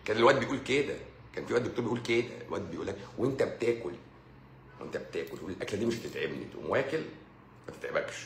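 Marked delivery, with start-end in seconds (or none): none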